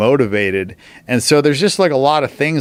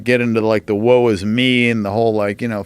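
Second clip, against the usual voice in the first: usual speaking voice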